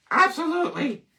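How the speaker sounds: high squeaky absolutely; mock emphatic agreement. Vlogger agreeing with himself, high squeaky voice. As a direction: pop it up high for effect.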